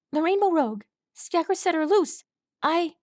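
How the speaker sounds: high, breathless frightened colt voice; panicked young colt. A narrator's reading. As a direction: blurt it out like a terrified little colt.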